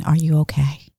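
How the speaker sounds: ordinary talk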